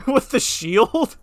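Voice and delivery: high, slow, loud disbelief; high-pitched incredulous question. Real, not acted. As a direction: stretch the question out in amused disbelief. Incredulous laughter at the game.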